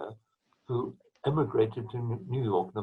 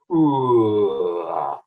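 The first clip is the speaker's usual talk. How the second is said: sliding "ooh" falling into "ah", loud at first; lip slur demo; 2 semitones lower, 7 dB louder than usual. Shown as a demonstration. Trombonist showing a lip slur by voice, loud falling slide. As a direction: swoop downward from a loud ooh to ah. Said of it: "like that".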